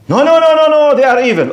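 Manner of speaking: high, hurried string of objections; mock protest. Humanist objecting to following Nazis, high mock protest. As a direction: act out a doubter protesting, pitch leaping up. Acting the doubter's hasty objection.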